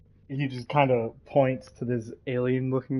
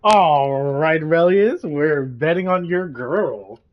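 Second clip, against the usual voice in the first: louder, slow hyped announcement; bookie hype; 3 semitones higher, 7 dB louder, slower than usual. Acted. Bettor telling Relius the bet, loud and hyped.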